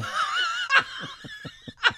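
big laugh. Real, not acted. Laughing at impression.

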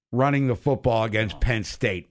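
normal talking voice

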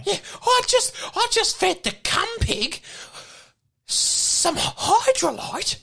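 very high, squeaky little player; high-pitched simpleton with halting delivery; Ducky, telling his story. Acted. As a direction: tell it slowly in a tiny high voice.

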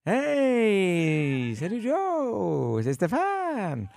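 voix aiguë et traînante d'un ami; message vocal de chum. Retold or imitated. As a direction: imiter un ami qui laisse un message vide. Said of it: "puis que ton message, c'est". (voix aiguë de chum au répondeur)

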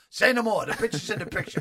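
loud, emphatic Rodney line; booming approval. Retold or imitated. Rodney grandly granting the wife a part, booming. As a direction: boom it out like a big-shot star.

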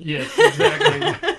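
high, slow, laughing through the words; laughing agreement. Real, not acted. Host agreeing with a cohost's spelling joke, laughing. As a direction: agree while cracking up.

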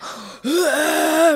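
high, drawn-out mock groan; imitated labour groan.

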